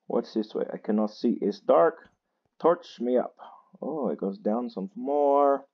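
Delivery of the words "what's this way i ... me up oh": ordinary talk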